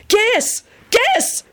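very high, frantic urging; desperate. A narrator's reading. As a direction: urge him desperately, each cry sharp. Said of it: "Benji said desperately".

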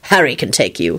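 understated irritation; snapped. A narrator's reading. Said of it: "he snapped".

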